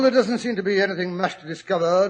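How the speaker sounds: ordinary talk, nothing marked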